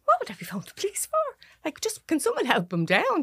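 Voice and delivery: high, rushed, breathless panic; panicked disbelief. Retold or imitated. To the neighbour asking about police, high panicked disbelief. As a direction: blurt it out in shocked confusion.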